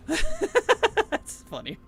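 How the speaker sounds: high, drawn-out laughing words. Real, not acted. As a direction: stretch it out through the laugh.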